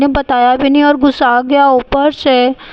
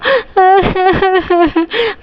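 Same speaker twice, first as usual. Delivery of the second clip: put-on child sobbing; 5 semitones higher than usual. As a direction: cry like a scolded little girl. Girl crying before parents.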